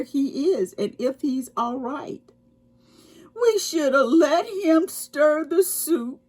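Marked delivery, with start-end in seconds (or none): 3.4-6.2 high, teary sniffling voice; a small creature fighting back tears; sniffling squirrel. A narrator's reading. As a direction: sniffle it out like a tiny sad squirrel. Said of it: "sniffled the squirrel".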